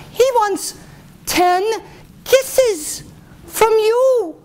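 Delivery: very high, halting squeak; reluctant lady in waiting. Acted. Lady in waiting breaking the news to the princess, high and halting. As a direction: dole it out word by word, very high.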